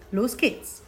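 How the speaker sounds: usual speaking voice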